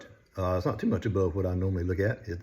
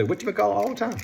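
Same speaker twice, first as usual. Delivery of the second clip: quoting people; 10 semitones higher, faster than usual. Retold or imitated. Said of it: "I hear people say".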